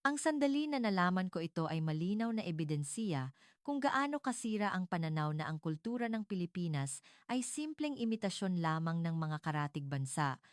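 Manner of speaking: ordinary delivery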